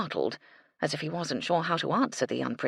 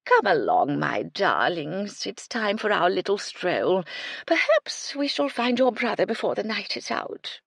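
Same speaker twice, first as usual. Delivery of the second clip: cooing dowager; 4 semitones higher, 3 dB louder than usual. A narrator's reading. Lady Belville cooing to her imaginary birds. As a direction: coax imaginary birds tenderly.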